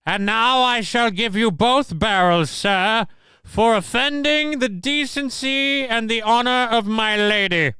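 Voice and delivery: high, formal Southern gent voice; pompous duelling gentleman; old Southern gentleman. Acted. Clip of hillbilly firing shotgun.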